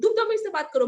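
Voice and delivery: high and loud, hitting तुम hard; angry shout imitated. Uncle repeating his demand, loud mock anger. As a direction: snap it back like furious uncle. Uncle and her quarrel retold.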